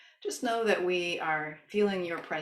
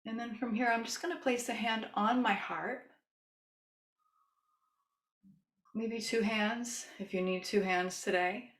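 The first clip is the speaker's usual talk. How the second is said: soothing yoga teacher. Shown as a demonstration. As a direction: gently lead a loving kindness meditation. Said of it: "as I start to use my yoga voice".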